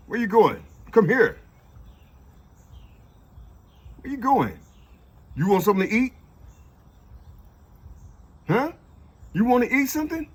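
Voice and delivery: high coaxing pet voice; talking to a cat. Real, not acted.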